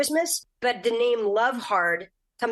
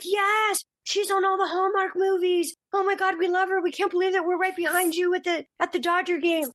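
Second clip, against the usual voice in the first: high, thrilled fan gushing, a playful imitation; 10 semitones higher than usual. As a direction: imitate starstruck ladies, high and breathless. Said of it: "They're like".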